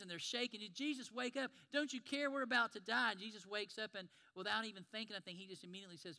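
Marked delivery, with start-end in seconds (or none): 0.7-3.1 higher, louder desperate cry to wake him; panicked plea. Acted. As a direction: shout as if the boat is sinking. Panicked disciples in the storm.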